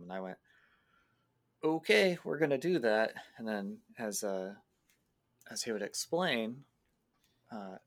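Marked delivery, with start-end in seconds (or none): 1.6-3.1 loud, high-pitched retold go-ahead; emphatic agreement. Player retelling their reply to Eddie's message, emphatic agreement. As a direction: jump on the plan, louder and higher.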